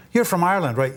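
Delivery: an eager American quizzing him about Ireland; mock American asker. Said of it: "when I get".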